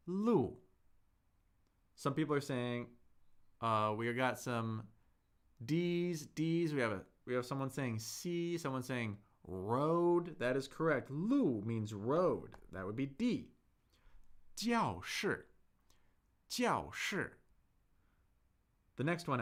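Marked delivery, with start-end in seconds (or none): 0.1-0.6 路 said with a sharp falling fourth tone. Shown as a demonstration. Teacher quizzing the chat, sharp fall on 路. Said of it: "what letter do you think 路 is?". 14.6-17.3 教室 with both 教 and 室 falling sharply; two hard drops on 教室; two fourth tones. Shown as a demonstration. Teacher modelling 教室 for the class, two falling tones. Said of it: "two fourth tones".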